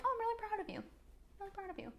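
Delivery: high, soft, proud; tender self praise. Shown as a demonstration. Telling yourself you're proud, softly and tenderly. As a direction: coo it to yourself gently. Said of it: "you said to yourself".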